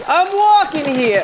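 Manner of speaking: hammed-up New York accent. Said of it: "You sound like you were from Boston".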